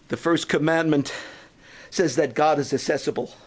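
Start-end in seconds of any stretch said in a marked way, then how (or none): none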